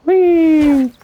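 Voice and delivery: high, loud gleeful squeal. Real, not acted. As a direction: squeal with delight. Jumping off a ledge.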